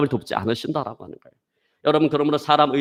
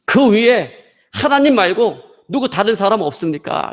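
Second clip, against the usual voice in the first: high, loud call up the cliff; comic desperate shout, pitched up; shouting for help; 6 semitones higher, 3 dB louder than usual. Retold or imitated. Climber seeks someone other than God, loud acted shout. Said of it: "이렇게 소리치기 시작했습니다".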